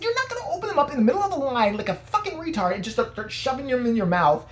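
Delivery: high, exasperated telling-off; worked-up, high-pitched reprimand; retold scolding. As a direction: scold a friend in mock outrage.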